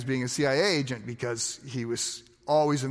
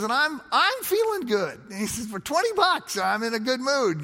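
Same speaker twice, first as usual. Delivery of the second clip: much higher, giddy mock delight; imitating giddy excitement; 8 semitones higher than usual. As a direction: play the thrilled pastor, pitch way up. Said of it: "all excited".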